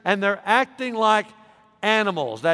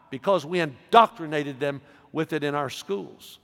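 normal talking voice

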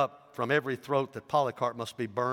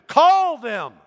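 very high, very loud dare; defiant shout; 14 semitones higher, 14 dB louder than usual. A narrator's reading. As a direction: shout the dare back at him. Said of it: "replied the old man".

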